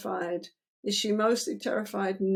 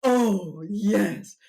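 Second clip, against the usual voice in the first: louder, delighted oh yes; warm gushing oh yes; 5 dB louder than usual. Shown as a demonstration. Therapist praising client like a pet, loud delighted. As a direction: burst out with real delight. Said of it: "We say".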